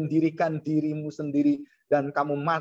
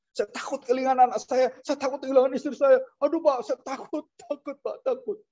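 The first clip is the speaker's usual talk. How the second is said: higher, pleading, panicky retelling; scared pastor quoted to the preacher, pleading in fear; imitating a frightened pastor; 8 semitones higher than usual. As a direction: voice the young pastor begging, scared. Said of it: "masih muda malah ketakutan".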